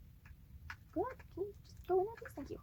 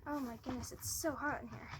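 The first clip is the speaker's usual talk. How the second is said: ordinary talk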